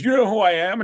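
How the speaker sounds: high, loud mock indignation; self-important challenge. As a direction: pull rank like a puffed-up college star. Acting out kicker pulling rank.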